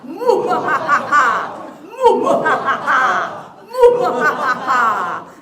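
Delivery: loud, slow evil mwahaha; villain cackle. Shown as a demonstration. Laughter yoga leader doing a dastardly villain cackle. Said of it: "the dastardly mean guy in the comics".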